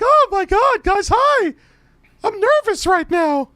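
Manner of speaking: very high, loud falsetto; mock nervous caller. Host imagining the rival nervous on the show, loud squeaky falsetto. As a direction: squeal it way up high, hammed-up nerves. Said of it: "he probably like".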